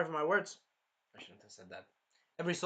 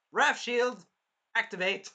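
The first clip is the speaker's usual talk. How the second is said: raised, forceful command; power call-out; 5 semitones higher, 6 dB louder, slower than usual. Retold or imitated. Shield hero deciding to unleash wrath, loud call-out. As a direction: announce the special move loudly.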